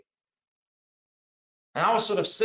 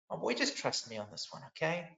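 loving father; 9 dB quieter than usual. Acted. Loving father asking his boy to trust him, softly reassuring. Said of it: "as though a loving father was just saying".

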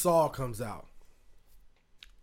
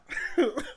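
laugh; 11 semitones higher than usual. Real, not acted.

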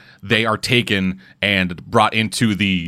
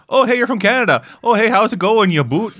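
mock Canadian accent; 9 semitones higher than usual. Mocking people who meet a Canadian, hammed-up Canadian accent. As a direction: pitch it up in a silly Canadian accent. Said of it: "whenever people are like".

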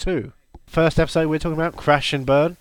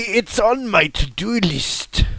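slow, higher hammed-up Shrek; Shrek accent; 6 semitones higher, slower than usual. Co-host inventing a Shrek line, mock Shrek accent. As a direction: put on an insistent Shrek brogue.

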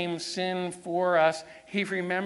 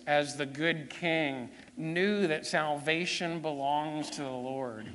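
usual speaking voice

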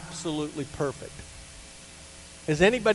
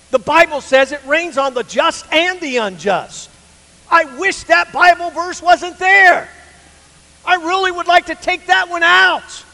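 much higher, loud impassioned preaching; full-force preaching; 11 semitones higher, 9 dB louder than usual. Acted. Pastor telling the church of his tumor, loud and impassioned. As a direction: pour it out from the pulpit.

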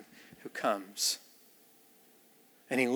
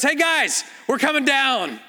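chill bro call from the roof; 8 semitones higher, slower than usual. Retold or imitated. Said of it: "and he goes".

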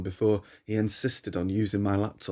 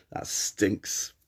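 whispered, softer disgust; 5 dB quieter than usual. Acted. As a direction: breathe it out in disgust. Narrator disgusted by the stench.

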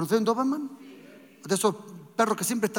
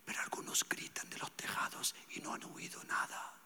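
soft intimate whisper, much quieter; whispered preaching; 18 dB quieter than usual. Acted. Preacher whispering to the congregation that the Spirit loves silence. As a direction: lean in and whisper like a secret.

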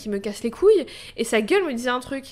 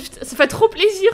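excited; 9 semitones higher, 7 dB louder than usual. Retold or imitated. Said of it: "Je suis en mode".

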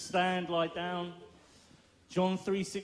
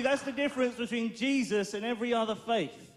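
ordinary delivery

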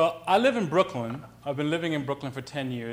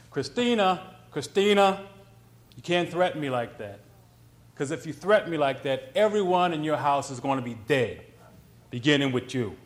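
loud Brooklyn tough guy, acted; Brooklyn accent; 5 dB louder than usual. Man warns the woman on the street, loud Brooklyn threat. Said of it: "That's my Brooklyn accent".

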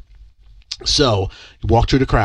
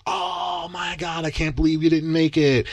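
mock surprise; 6 semitones higher than usual. Camera crew sympathizing.

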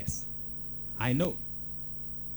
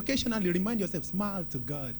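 ordinary delivery

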